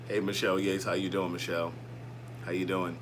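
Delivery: very low, quiet baritone voice; mock-deep imitation of a baritone woman; Michelle Yates impression. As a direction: drop right down into a soft baritone greeting. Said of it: "that was me doing Michelle Yates', um, voice".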